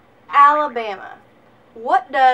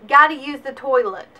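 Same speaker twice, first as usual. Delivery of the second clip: usual speaking voice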